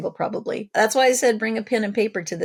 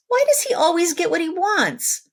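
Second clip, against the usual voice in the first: put-on much higher, louder childish complaint; fuming child; 11 semitones higher, 4 dB louder than usual. Other sibling fuming at the parent, high whiny child. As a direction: whine it like an unfairly treated kid. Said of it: "the other child fumes".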